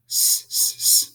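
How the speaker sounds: unvoiced hissed "s" repeated; sounding out s. Shown as a demonstration. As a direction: hiss a clean "s" three times. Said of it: "That's an easy one".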